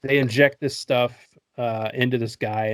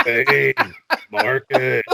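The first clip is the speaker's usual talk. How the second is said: drawn-out two-word brute voice; hammed-up henchman echoing an order; mock dumb-henchman voice; dim henchman Bane; much slower than usual. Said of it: "That just speaks in two-word sentences".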